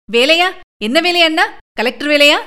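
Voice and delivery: put-on girl thrilled her brother found a job; surprised delight. A narrator's reading. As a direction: jump on the news with wide-eyed eagerness. Sister thrilled at brother's news.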